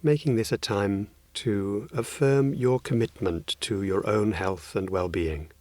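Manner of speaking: ordinary delivery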